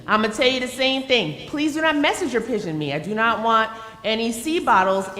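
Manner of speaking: usual speaking voice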